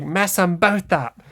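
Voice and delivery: squeaky little Yugi egging on the fight; tiny-kid voice cheering on a street brawl; little Yugi voice as a thug. Retold or imitated. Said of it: "little Yugi's voice".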